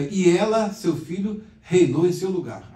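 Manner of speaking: quoting the verse badly, stress on E; reading with pronoun ela. Shown as a demonstration. Professor lê o versículo com ela, tom mais alto. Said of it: "Até na leitura fica meio assim disforme".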